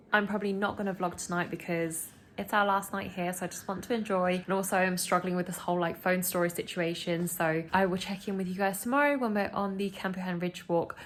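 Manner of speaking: ordinary talk, nothing marked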